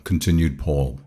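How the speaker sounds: ordinary delivery, nothing marked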